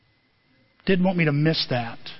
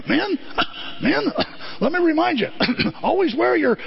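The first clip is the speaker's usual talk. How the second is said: coughing shop teacher; 8 semitones higher than usual. Retold or imitated. Imitates coughing woodworking teacher.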